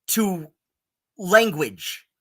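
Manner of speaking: usual speaking voice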